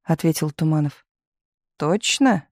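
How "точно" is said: higher, slyly probing question. A narrator's reading. Misha squinting at Tumanov, slyly questioning. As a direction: ask it with a sly lift in the voice. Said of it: "хитренько прищурился Миша".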